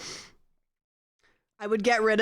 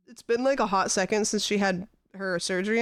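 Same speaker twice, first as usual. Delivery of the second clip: usual speaking voice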